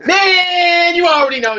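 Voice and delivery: high mock yell falling away; mock shout. Echoing a friend's catchphrase back, hammed-up shout. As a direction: burst out high and loud, then settle.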